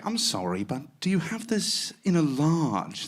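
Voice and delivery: slow, put-on British accent. Shown as a demonstration. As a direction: ask politely like a charming Brit. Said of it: "if I go up and say".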